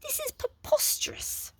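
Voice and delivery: high fretful gentleman; panicked Flabbergast. A narrator's reading.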